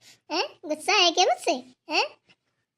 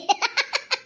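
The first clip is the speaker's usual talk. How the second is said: giggle; 6 semitones higher than usual. Acted. Girl laughs at grandmother's joke.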